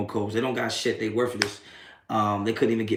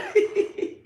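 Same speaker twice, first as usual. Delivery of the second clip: high squealing laugh; high cackle. Real, not acted. Laughing at a troll in chat, high squeal.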